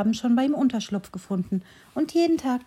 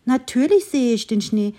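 higher, louder enthusiastic little tree; cheerful tree calling; 7 semitones higher, 4 dB louder than usual. A narrator's reading. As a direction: call out the beauty of the snow. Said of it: "rief das Bäumchen". (fröhlich rufendes Bäumchen, höher und lauter)

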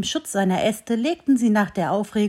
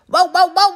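high, loud yapping barks; dogs barking; 9 semitones higher, 8 dB louder than usual. A narrator's reading. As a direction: bark like eager hunting dogs. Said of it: "bellten die Jagdhunde".

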